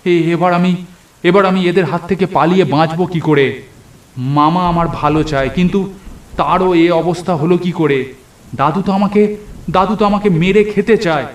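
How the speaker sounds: put-on the trapped boy panicking about how to escape; panicked fear. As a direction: stumble over the words in fright.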